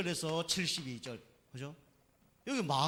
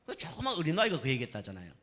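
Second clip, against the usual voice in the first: ordinary talk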